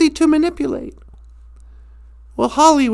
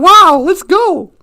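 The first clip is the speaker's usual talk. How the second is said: eager; 3 semitones higher, 6 dB louder, slower than usual. Retold or imitated.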